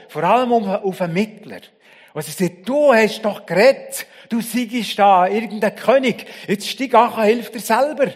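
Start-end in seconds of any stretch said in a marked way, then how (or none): 2.7-8.1 loud, high taunting jeer; mocking jeer. Preacher voicing the crowd mocking the crucified, loud jeer. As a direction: sneer it down at the cross. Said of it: "ein verhöhnendes Echo".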